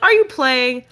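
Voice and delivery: put-on the dog imagined as a happy kid joining a game; playful dog voice. Owner voicing her dog as if it thinks choking is a game, playful puppy voice. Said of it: "She'd be like".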